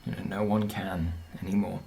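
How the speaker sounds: low, soft reply; subdued answer. Acted. Father telling his daughter no one can leave, low and subdued. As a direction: break it to the child quietly.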